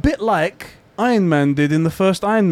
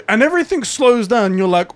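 ordinary talk, nothing marked

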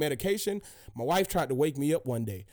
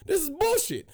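retold outrage; 10 semitones higher than usual. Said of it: "I was like".

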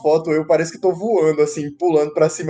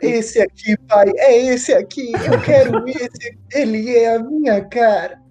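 put-on excited kid voice; 6 semitones higher, slower than usual. Kid begging dad in store.

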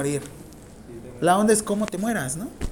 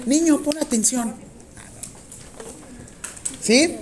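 put-on high-pitched call to a child; calling children to attention; 8 semitones higher than usual. Teacher mock-addressing the class as children, high-pitched call for attention. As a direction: talk as if to little kids, pitched high. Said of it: "Ahí le estaba con los niños".

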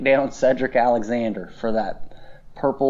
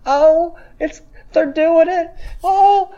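high, loud, teary fan squeal; choked-up fan; 14 semitones higher, 4 dB louder, slower than usual. Retold or imitated. To the podcast cohost, reliving a wrestling return, high choked-up squeal. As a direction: squeal like a kid overwhelmed. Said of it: "started to get choked up".

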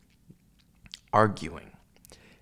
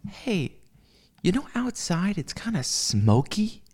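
lightly mimicked woman, higher pitch; imitating her; 7 semitones higher, slower than usual. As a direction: imitate her with a high, questioning lift. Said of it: "and she said".

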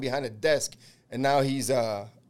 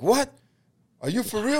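stunned disbelief; 9 semitones higher than usual. Retold or imitated. Pardoned man hears the news on the phone, stunned. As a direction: squeak it out, can't believe it.

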